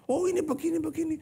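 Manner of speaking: high-pitched imitation of bickering; mock squabbler. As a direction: parody someone butting into an argument. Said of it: "Sekarang di sini ribut".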